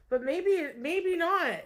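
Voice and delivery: playful high. Acted. Teasing the listener in a high playful lilt.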